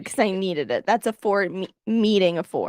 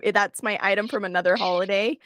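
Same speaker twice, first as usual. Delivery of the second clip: usual speaking voice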